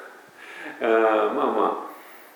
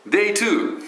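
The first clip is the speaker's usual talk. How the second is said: excited announcement; 12 semitones higher, 4 dB louder than usual. Real, not acted. Announces day two of series.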